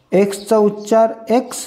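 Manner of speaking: normal talking voice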